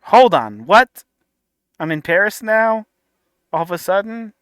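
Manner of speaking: loud jolted hold on, what rising high; stunned what. Real, not acted. Reacting to the clip, a loud startled "what?". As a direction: cut in, baffled by the Paris story.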